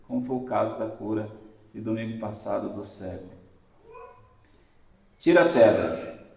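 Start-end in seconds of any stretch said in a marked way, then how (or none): 5.2-6.2 firm, loud command; commanding order. Acted. Preacher voicing Jesus at the tomb, loud command. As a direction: order them to roll the stone away. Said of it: "Jesus dá a ordem".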